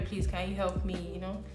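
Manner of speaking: ordinary delivery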